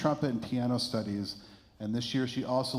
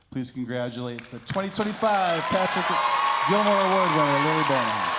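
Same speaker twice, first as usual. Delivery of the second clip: announcing; 4 semitones higher, 7 dB louder than usual. Real, not acted. Announcing the award winner.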